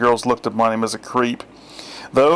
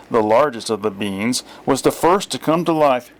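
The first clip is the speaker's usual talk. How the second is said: plain reading voice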